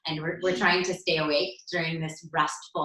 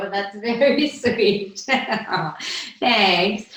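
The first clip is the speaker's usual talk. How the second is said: higher, louder, laughing thanks; touched laughing; 3 semitones higher, 5 dB louder, much slower than usual. Real, not acted. Mother thanking a child for a sweet answer, touched laughing. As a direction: laugh fondly through the words.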